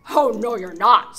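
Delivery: huge troll roar. Acted. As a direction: bellow like an angry troll. Troll shouts at goat.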